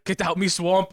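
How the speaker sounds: nervous pick-up line in a put-on Scottish accent; Shrek. Pretending to approach an Irish girl, nervous mock ogre accent. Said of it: "get nervous, and be like".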